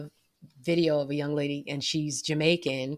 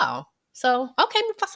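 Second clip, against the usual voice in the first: teasing okay Mufasa; 8 semitones higher than usual. Retold or imitated. Teasing reaction to his interview.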